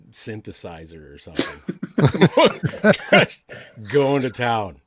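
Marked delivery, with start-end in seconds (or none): 1.8-3.1 loud laugh. Real, not acted. Amused at bad audio.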